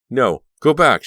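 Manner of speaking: loud, high warning; crying out. A narrator's reading. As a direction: warn them off. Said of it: "she cried".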